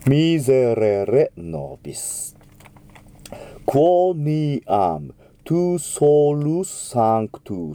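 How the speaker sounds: slow, drawn-out Latin; slow model diction. Shown as a demonstration. Teacher reading the Gloria slowly. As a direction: draw out each Latin word. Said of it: "この言葉は歌い方によって".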